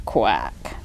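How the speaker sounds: very low, faint quack; a duck at the end of its strength; exhausted duck answering the farmer; low weary quack. A narrator's reading. Duck voiced in read story.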